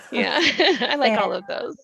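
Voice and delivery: words bubbling through real laughter; laughing. Real, not acted. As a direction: laugh it out, tickled by the praise. Laughing at being named.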